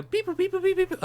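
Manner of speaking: high-pitched mouthed computer beeps; machine beeping. Pretend. Host booting up a pretend timeline machine, high beeping. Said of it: "I got a machine here".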